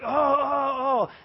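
drawn-out "oh, oh, oh" of frustration; frustrated groan. Acted. Pastor acting out everyday frustration for the congregation, frustrated groan. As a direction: groan it out as if fed up. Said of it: "you ever get really frustrated".

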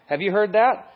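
ordinary delivery

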